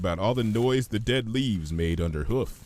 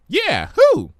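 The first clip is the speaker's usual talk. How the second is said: loud, much higher perky echo; Pinky's echo; 10 dB louder than usual. Acted. Pinky startles Fluttershy, loud high bouncy echo. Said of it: "Pinky echoed".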